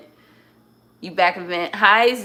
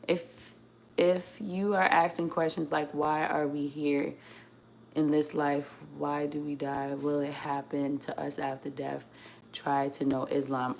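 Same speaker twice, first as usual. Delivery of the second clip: low, subdued read-aloud; quiet, low reading voice; reading a comment aloud; 4 semitones lower, 10 dB quieter than usual. Real, not acted. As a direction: read it out softly and evenly. Reads out a viewer's comment.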